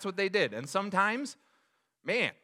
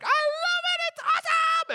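squealed very high and loud with delight; thrilled daughter; 7 dB louder than usual. Retold or imitated. Daughter gushing about Splash Mountain, squealing very high. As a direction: play a kid shrieking with joy. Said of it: "One of our daughters was like".

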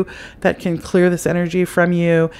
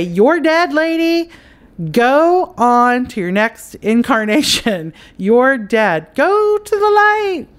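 commanding the ghost; 7 semitones higher, slower than usual. Shown as a demonstration. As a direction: order the dead woman firmly toward the light. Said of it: "you're gonna say".

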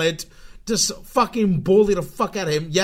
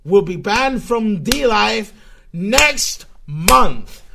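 ordinary talk

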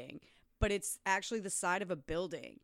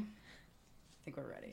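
slow hushed whisper; 5 semitones lower, 18 dB quieter, slower than usual. Real, not acted. Host whispers before the podcast starts. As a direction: whisper it slowly under your breath.

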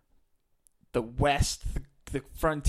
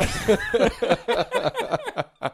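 high laugh; 9 semitones higher, 4 dB louder than usual. Real, not acted. Laughing at own joke.

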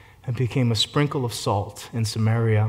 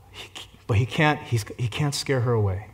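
ordinary talk

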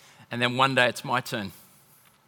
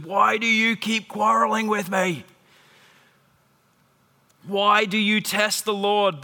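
higher-pitched, reproachful questioning; exasperated rebuke; 5 semitones higher, slower than usual. Acted. Moses to the quarreling people, exasperated rebuke. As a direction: challenge them with raised pitch. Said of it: "the people quarreled with Moses".

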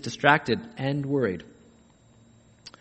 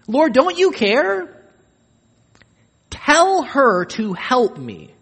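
higher, louder, slow reproach; reproachful Martha; 9 semitones higher, 6 dB louder, slower than usual. Retold or imitated. Martha reproaches Jesus, higher and louder. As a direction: reproach the Lord pointedly. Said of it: "Note the reproach".

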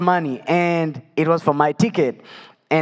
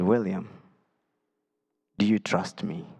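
lightly voiced God, low and soft; a quiet, lower voice, gently questioning with a long pause; 5 semitones lower, 6 dB quieter than usual. God asking a crying man for trust, quiet retold voice. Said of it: "hear this voice".

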